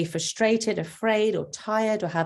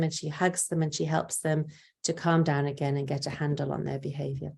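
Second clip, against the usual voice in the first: ordinary delivery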